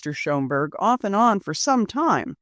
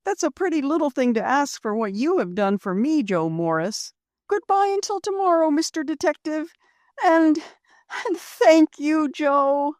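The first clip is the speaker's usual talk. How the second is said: Rose's voice; 9 semitones higher than usual. Acted. Rose voiced by narrator.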